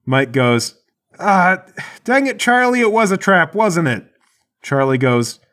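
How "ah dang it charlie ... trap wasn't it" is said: high-pitched, griping holler; exasperated yell. Acted. Mike suspecting Charlie fell for a trap, exasperated yell.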